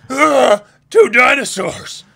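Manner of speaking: high, loud, slow alarmed shout; startled cry. Acted. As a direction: yelp at the sight of dinosaurs. Reading a character's startled cry.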